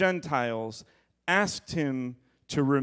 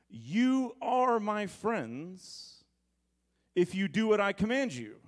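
emphatic; 7 semitones higher than usual. Acted. Preaching Jesus' words, slowed.

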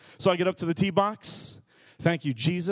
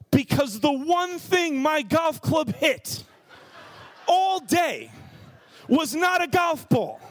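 exasperated shout; 9 semitones higher, slower than usual. Real, not acted. Preacher telling the congregation the phone broke, exasperated shout. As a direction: build to the shattered-phone punchline. Punchline about the shattered phone.

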